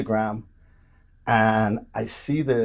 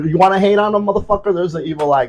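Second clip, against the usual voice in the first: loud, higher-pitched defiance; mock challenge; 6 semitones higher, 8 dB louder, faster than usual. Speaker daring haters of his Crocs, mock defiance. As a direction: taunt the haters, loud and quick.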